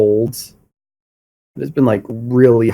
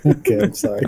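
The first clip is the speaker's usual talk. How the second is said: laughing apology; 11 semitones higher, faster than usual. Real, not acted. Laughing at being refused forgiveness.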